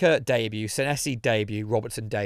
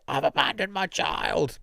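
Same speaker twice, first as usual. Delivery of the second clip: hammy dramatic actor; 3 semitones higher, 4 dB quieter, slower than usual. Retold or imitated. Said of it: "men screaming and crying".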